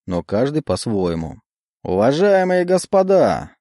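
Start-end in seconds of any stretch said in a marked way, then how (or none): 1.8-3.5 emcee. Acted. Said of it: "раздался голос конферансье".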